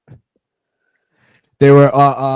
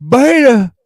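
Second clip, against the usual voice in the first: high, loud mocking put-down; Jesse impression; 9 semitones higher, 8 dB louder than usual. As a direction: sneer it like a radio host. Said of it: "me doing Aaron Nimhold doing Jesse".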